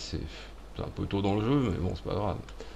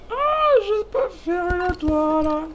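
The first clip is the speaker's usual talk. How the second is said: silly high voice; 4 dB louder, slower than usual. Pretend. Crafting menu excitement.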